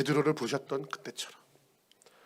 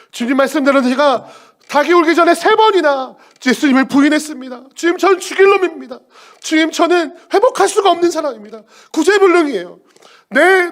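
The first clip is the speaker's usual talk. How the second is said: high, loud lament of a broken man; anguished plea; 12 semitones higher, 13 dB louder than usual. Acted. Peter pleads before Jesus, loud and anguished. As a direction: cry out in loud despair.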